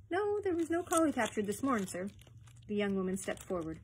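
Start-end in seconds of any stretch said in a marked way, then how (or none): 0.1-2.1 higher-pitched Scots functionary denying it; high, protesting Ferguson; bluffing denial. Acted. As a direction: deny it innocently, pitched up.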